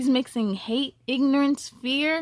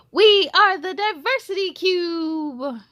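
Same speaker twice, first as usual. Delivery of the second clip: proud, higher, louder group cheer; shout together; 6 semitones higher, 6 dB louder than usual. Acted. Squares cheering together at the festival's end, proud shout. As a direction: call it out as one voice. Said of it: "the square shouted".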